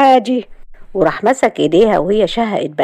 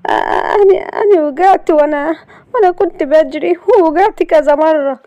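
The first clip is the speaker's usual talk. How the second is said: high, tearful stammer, louder; 10 semitones higher, 4 dB louder than usual. A narrator's reading. Layla tearfully explaining her fall to Galal, higher and louder. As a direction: stammer it out through tears.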